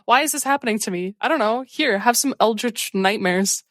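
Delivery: normal talking voice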